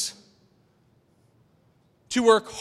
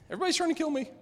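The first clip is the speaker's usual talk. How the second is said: laughing quip; 7 semitones higher, 4 dB quieter, much faster than usual. Real, not acted. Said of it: "laughs then quips".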